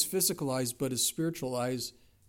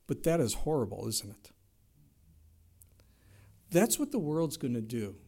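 ordinary delivery

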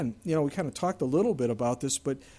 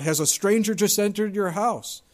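loud, higher demanding call; 6 semitones higher, 6 dB louder than usual. Acted. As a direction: pursuers shouting at the door. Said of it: "they cried out".

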